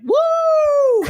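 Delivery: high, loud "woo"; drunken party whoop. Retold or imitated. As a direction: whoop like a drunk making a scene. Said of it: "getting wasted off her ass and".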